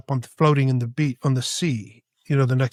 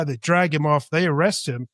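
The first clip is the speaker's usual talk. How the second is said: ordinary talk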